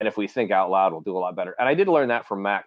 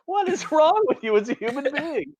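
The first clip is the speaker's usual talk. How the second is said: mock high scolding.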